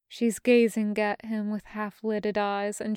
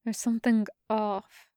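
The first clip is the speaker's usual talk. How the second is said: plain reading voice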